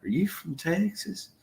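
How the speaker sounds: high, soft mimicked question; imitating curious Wisconsinites. Retelling dairy farm guys' question, high-pitched imitation. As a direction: lift the voice way up to quote puzzled locals. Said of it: "So they were like".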